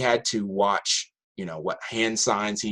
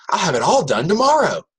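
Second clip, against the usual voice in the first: put-on higher, louder eager teen voice; Brenda's eager promise; 9 semitones higher, 5 dB louder than usual. Student Brenda promising her homework to the teacher, eager higher voice. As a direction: promise it like a kid who just got a deal. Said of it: "She goes".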